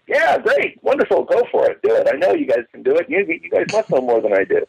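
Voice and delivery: mock starry-eyed enthusiasm; naive eager kid. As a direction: play the trusting kid who agrees to everything. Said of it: "and I was like".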